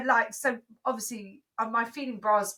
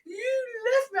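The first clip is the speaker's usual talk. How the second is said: high-pitched mock wail; tearful little-kid complaint, put on; imitated crying child; 12 semitones higher than usual. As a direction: mimic a child crying her eyes out. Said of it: "crying her eyes out".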